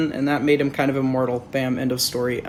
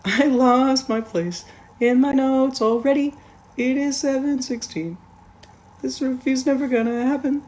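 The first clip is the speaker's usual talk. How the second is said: higher whiny mock voice; self-mocking voice; 8 semitones higher than usual. Reviewer mocks himself for losing notes, higher voice. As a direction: whine about your own notes.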